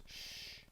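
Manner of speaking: hushed, voiceless "shh"; imitated shushing. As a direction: act out a warning shush, very softly. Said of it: "Sosa goes like this".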